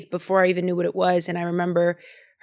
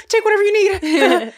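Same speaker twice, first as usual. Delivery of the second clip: higher, quick mock-frightened giving up; scared surrender; 11 semitones higher, 4 dB louder, faster than usual. Offering the robber everything, high and mock scared. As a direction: hand it all over in a hurry, mock panic. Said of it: "I'm a little like".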